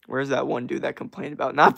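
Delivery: ordinary delivery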